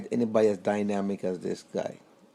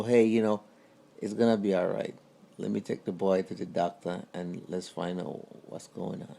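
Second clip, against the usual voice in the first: calm reassurance; 4 semitones lower, 5 dB quieter than usual. Retold or imitated. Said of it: "granddad reassures grandmother".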